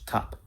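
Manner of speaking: everyday English top; regular English T. Shown as a demonstration. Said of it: "This is with a regular T in English".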